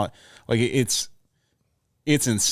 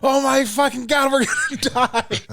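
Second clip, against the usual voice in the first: shrill panicked shriek; 14 semitones higher than usual. Retold or imitated. Probie panicking in the back with the kids, shrill shriek. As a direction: squeal it like a terrified young firefighter. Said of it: "this shrill".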